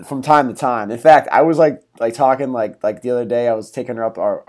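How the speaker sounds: ordinary delivery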